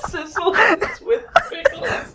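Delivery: laughing hard, high and slow. Real, not acted. Cohost laughing in disbelief at the storyboard line. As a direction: repeat it through helpless laughter. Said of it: "Where do you see this?".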